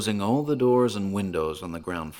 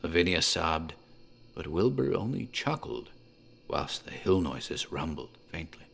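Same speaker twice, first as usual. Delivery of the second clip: ordinary delivery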